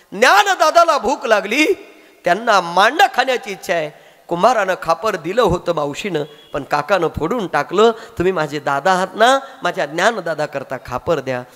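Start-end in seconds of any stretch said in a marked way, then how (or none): none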